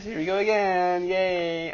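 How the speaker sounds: high, sing-song mock enthusiasm; sarcastic cheer. Real, not acted. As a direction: fake cheer over a redo. Restarting a lost recording.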